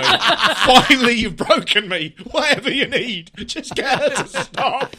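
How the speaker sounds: squeaky laughing surrender, slow; laughing plea. Acted. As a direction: beg for mercy while cracking up. Laughing through a mock plea.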